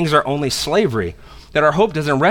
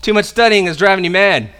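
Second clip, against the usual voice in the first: raised voice, higher and forceful; loud exclamation; 5 semitones higher, 7 dB louder than usual. Acted. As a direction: exclaim it loudly in accusation. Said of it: "exclaimed in a loud voice".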